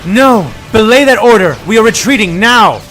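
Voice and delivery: loud, high general's order; commanding shout. Acted. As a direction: stop them cold, full voice. General calls off her troops.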